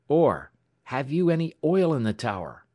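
high, sharp, pressing question; urgent query. A narrator's reading. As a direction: shoot the question out. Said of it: "shot Alex".

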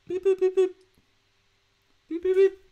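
high loud alarm beeps; mouthed car alarm. Pretend. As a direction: beep beep like an alarm going off. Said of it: "The final alarm has gone off".

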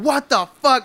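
high, rising startled outburst; startled awake. Retold or imitated. Sleeper blasted by music next to a speaker, startled outburst. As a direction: jolt awake in high-pitched disbelief.

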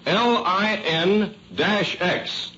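ordinary talk

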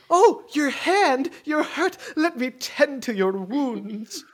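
high, drawn-out swooning concern; mock fawning concern. [name] fussing over Adrian's hurt hand, mock fawning. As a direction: coo over the injury, hammed-up.

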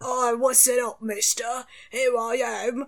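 loud, laughing Cockney orc voice. Acted. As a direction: greet them as a cheeky Cockney orc. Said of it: "And orcs are Cockney".

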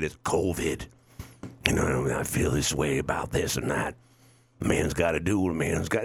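hammed-up frail aging actor; mock dying old actor. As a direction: croak it out like a fading movie star. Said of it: "they start talking like this".

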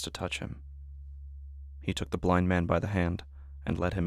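soft, hushed Bible reading; a hushed reading from Mark; solemn scripture reading. Acted. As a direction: read the gospel quietly and reverently. Reads scripture passage.

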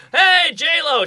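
high, eager mock holler; calling out. Calls out to passing star.

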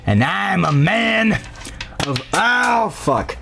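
put-on slow, hammy old grandpa with long pauses; eccentric old man. Grandpa promises a gift for the meat, drawn-out old-man voice. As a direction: declare it as an old man, drawing out words.

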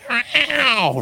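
a high meow with a falling end; loud cat meow. Retold or imitated. Retelling the spirit box, a high meow. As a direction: mimic a cat's drawn-out meow. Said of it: "real loud".

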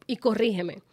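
normal talking voice

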